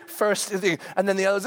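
usual speaking voice

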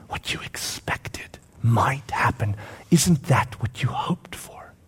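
slow, hushed questioning; tender whisper. Acted. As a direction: whisper it gently to a disheartened friend. Jesus tenderly questioning Peter.

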